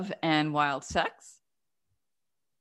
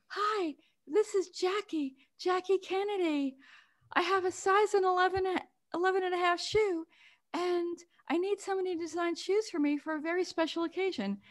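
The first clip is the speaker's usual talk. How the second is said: Jackie Kennedy; 11 semitones higher, 4 dB quieter than usual. Retold or imitated. Recounting the famous caller to her mother, high and hushed. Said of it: "And the woman said".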